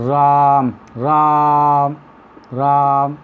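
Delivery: राम with the आ held extra long; long-held vowel. Shown as a demonstration. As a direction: stretch the आ in राम to three beats. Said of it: "तीन मात्रा का समय लग रहा है".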